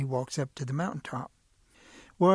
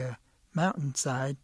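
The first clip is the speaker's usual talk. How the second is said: plain reading voice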